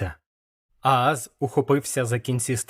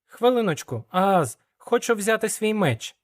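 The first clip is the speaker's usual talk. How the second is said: put-on higher teasing young voice; boy poking fun at the dealer; young apprentice; 9 semitones higher than usual. Hero asks to fetch sword.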